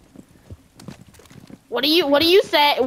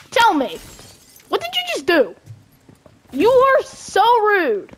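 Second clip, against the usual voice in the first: mock outraged scolding; 9 semitones higher, faster than usual. Scolding a teammate in-game.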